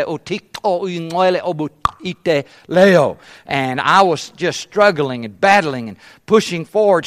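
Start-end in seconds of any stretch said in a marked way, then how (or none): 0.0-3.2 the sentence said in Xhosa; spoken in Xhosa. Shown as a demonstration. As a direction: speak the line as a learner of Xhosa. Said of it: "I'd say".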